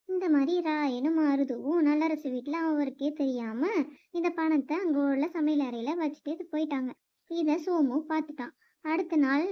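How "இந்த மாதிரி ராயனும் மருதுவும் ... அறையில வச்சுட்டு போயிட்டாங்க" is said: ordinary delivery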